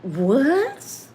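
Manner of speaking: high, rising shocked question; stunned disbelief. Retold or imitated. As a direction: blurt out your astonishment. Hears Ralph is coming down.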